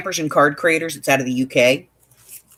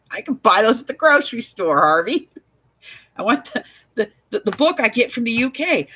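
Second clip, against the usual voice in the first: mock-exasperated refusal, pitched up; 6 semitones higher than usual. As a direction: turn down the agent's offers, half amused. Retold refusals of other magazines.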